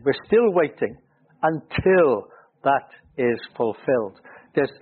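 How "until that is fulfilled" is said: "until" pressed hard, slow delivery; slow down and lean on "until"; "until" stressed and held, the rest slow and deliberate. Shown as a demonstration. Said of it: "great stress on the word".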